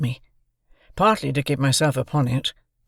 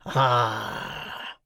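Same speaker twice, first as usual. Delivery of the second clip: muttering convict; 2 semitones lower, 5 dB quieter than usual. A narrator's reading. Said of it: "he muttered".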